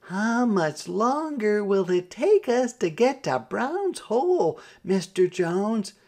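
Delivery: high-pitched call into the distance. A narrator's reading. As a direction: call it out to someone up ahead. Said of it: "when Mama called out".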